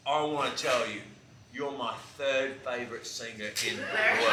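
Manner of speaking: badly faked Australian accent. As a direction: put on a hammy Aussie accent. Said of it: "once again, I'll do a bad accent".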